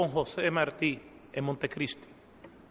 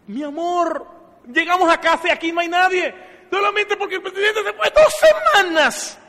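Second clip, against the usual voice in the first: indignant complaint; 11 dB louder than usual. Retold or imitated. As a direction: protest that nobody came to greet them. Husband complains to his wife.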